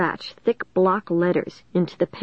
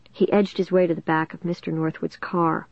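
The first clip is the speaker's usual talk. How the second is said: ordinary delivery, nothing marked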